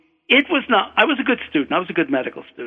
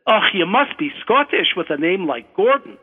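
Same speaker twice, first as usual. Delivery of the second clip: raised, punchy Scottish examiner's voice; Scottish accent; 4 semitones higher than usual. Retold or imitated. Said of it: "try to do my Scottish accent".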